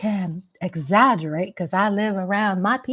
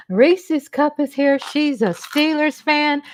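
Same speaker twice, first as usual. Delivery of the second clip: normal talking voice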